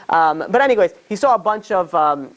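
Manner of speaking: usual speaking voice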